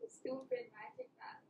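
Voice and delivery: giggly whispered aside. Real, not acted. Friend mocking the app under her breath, whispering. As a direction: whisper it through a laugh.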